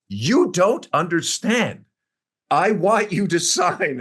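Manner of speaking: insistent boss. Retold or imitated. She stands over him, pointing.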